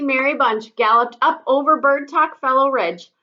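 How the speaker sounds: usual speaking voice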